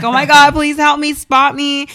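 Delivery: loud, high panicked plea to the trainer; retold yell. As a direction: cry out urgently for help. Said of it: "yell at the, the trainer".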